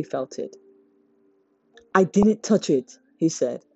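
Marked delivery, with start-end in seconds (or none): 1.9-3.0 irritated protest. A narrator's reading. Defending himself, irritated.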